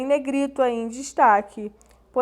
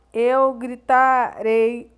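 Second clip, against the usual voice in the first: slow model reading; 6 dB louder, much slower than usual. Shown as a demonstration. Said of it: "é o penúltimo".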